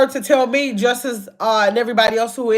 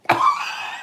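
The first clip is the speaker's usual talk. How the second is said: a sudden squeal of a laugh, way up high; high burst of laughter; 3 dB quieter than usual. Real, not acted. Host caught out by a viewer, squealing laugh. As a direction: break into a shrieking laugh.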